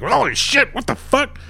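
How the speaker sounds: high, startled cry; shocked yelp at the exploding cage. Acted. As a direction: yelp as the pieces hit. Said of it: "He's like".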